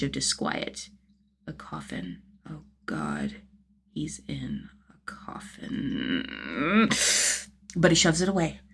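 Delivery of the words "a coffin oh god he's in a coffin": much lower and softer, unvoiced, slow; terrified breathy whisper; horrified whisper. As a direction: choke it out in dread. Claustrophobic panic in elevator.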